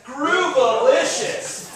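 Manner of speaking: sing-song Groovalicious with a groove; slow groovy drawl. Shown as a demonstration. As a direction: draw out Groovalicious like a funky dancer. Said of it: "we're all gonna go like this".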